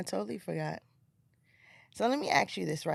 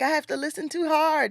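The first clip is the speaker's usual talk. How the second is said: mock complaint; 9 semitones higher, 7 dB louder than usual. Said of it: "I'm like".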